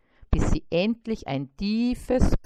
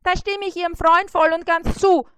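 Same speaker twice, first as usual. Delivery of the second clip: much higher, louder beetle voice; eager beetle; 10 semitones higher, 9 dB louder than usual. A narrator's reading. Trapped beetle agreeing with the fly, high loud voice. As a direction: chime in keenly. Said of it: "mischte sich der Käfer ein".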